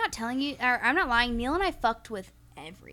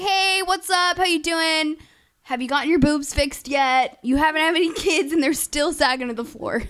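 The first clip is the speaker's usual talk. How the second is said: loud, higher-pitched sarcastic phone greeting; mock casual taunt; 6 semitones higher, 8 dB louder than usual. Daughter pretending to phone the stepmother, mock casual taunt. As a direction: call out a breezy, hammed-up needling hello. Acting out her teasing phone call.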